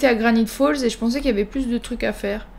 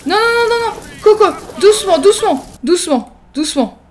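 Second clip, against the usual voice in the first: high, urgent pleading, easing down; panicked pleading; 6 semitones higher, 3 dB louder than usual. Pretend. Player trying to calm the burning scene, panicked pleading. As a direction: plead frantically, then settle. Panicking over fire, building down.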